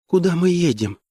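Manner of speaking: gentle woman's voice, pitched well up; narrator's high feminine voice; high tender voice. A narrator's reading. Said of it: "сказала Диана высоким нежным голосом".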